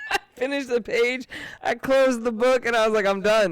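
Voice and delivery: wounded pride, loud and high; indignant loud retelling. Retold or imitated. Telling how his dad's teasing made him quit, loud and indignant.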